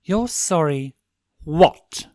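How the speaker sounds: stern correcting prince; demanding prompt. A narrator's reading. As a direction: snap for the rest. Stolas prompts again.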